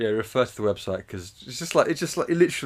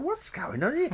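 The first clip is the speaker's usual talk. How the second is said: silly high voice. Pretend. High mock reaction.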